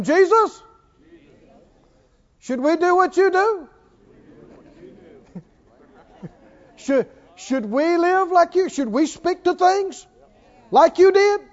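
high-pitched, mock-innocent questioning; asking Jesus. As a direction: call up to him with naive questions.

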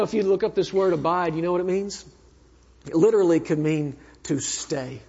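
ordinary delivery

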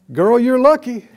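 voicing how people talk; flippant mock congratulation. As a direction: toss it out as a joke. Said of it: "people talk this way".

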